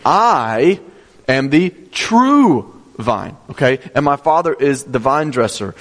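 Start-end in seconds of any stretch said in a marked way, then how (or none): 0.0-3.4 "I" held long and set apart, "true" drawn out; slowly, leaning hard on "I" and "true"; stress on I and true. Shown as a demonstration. Preacher showing the italicized words, stress on "I" and "true". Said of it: "You would emphasize the word".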